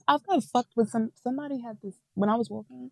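dropping to a near whisper. Real, not acted. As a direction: hush it like a secret. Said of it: "Why you whispering like that?".

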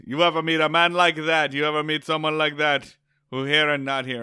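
loud, high mock Rastafarian accent; the guide teasing the tourist in a loud, high mock Rastafarian accent. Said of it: "he nudges and goes".

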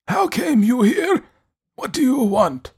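put-on high, slow, stern old man voice; imperious elderly recluse, demanding. A narrator's reading.

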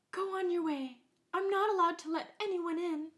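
put-on high girl voice.

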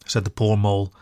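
ordinary delivery, nothing marked

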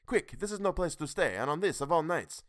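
high, loud panicky urging; frightened urging. A narrator's reading. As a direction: hurry them away in fear. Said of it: "The reply rang out quickly".

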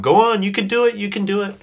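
put-on high, coaxing cheer as to a toddler; coaxing a baby. Mentor coaxing him like a toddler's first step, high cheering. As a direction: urge on like a baby's first step.

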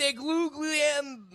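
put-on high, slow Italian accent; exaggerated Italian accent on the name. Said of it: "That was your Italian accent".

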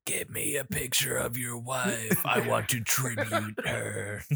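soft, slow mock-pervert whisper; hammed-up hushed creep voice; creepy whisper. Creepy whispered voice, joking impression.

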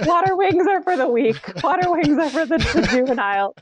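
hammed-up disdain; mock scorn. Mock boasting about tough community.

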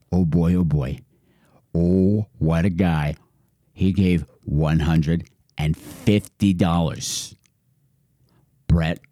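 low, slow reverent announcer; low slow awed announcer. Pretend. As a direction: savor the big gift in a deep voice. Awed low voice for big donor.

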